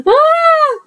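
very high, loud "ah"; short piercing shriek of fright; scared scream. Acted. Monsters left the kids alone, high frightened scream.

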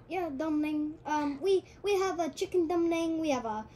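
restaurant order-taker with a put-on Chinese accent. Restaurant worker answering a customer, in a Chinese accent. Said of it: "the guy who's taking the order in the restaurant".

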